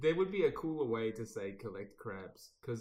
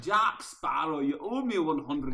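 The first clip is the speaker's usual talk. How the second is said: put-on loud, raised Scottish accent; hammed-up Scottish villain demanding payment; Davy Jones; 5 semitones higher, 9 dB louder than usual. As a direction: call it out in mock Scottish. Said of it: "He's saying he's Scottish".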